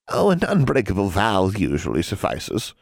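put-on an old witch toying with a girl; sly old hag. A narrator's reading. Hag bargaining with a young witch, lightly sly.

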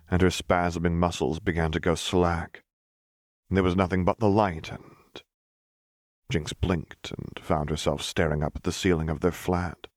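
plain reading voice

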